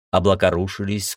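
ordinary delivery, nothing marked

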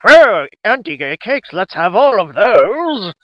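put-on Southern accent that slips into British; slow, drawn-out bad Southern accent. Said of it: "except in a Southern accent that's not nearly so British sounding".

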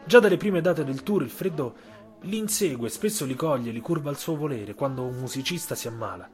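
ordinary delivery, nothing marked

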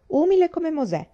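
high, loud, amused jab ending in a laugh; mocking laugh. Mary gossips to the girls about Kitty Alec, laughing. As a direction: laugh at the gossip you're telling.

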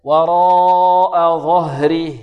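very slow chant, long وراء then ظهره closed as "ẓahrih"; pausal sukun. Shown as a demonstration. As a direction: stop on a vowelless h in ظهره. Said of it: "والوقف عليها بالسكون". (الوقف على هاء ظهره بالسكون)

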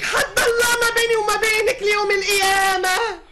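wife casting out her sister, furious and tearful; furious tearful shout. Acted. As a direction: scream her out of the house, weeping.

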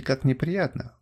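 ordinary delivery, nothing marked

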